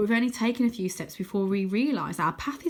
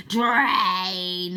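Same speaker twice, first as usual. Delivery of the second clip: drawn-out menacing hiss; Croaky draining Arthur's life, hissing; 5 dB louder than usual. A narrator's reading. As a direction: stretch the word like a spell. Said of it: "hisses Croaky".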